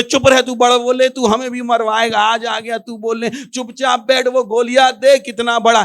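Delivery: high, urgent telling-off; agitated scolding. Retold or imitated. Hiding soldiers telling the boy to shut up, agitated scolding.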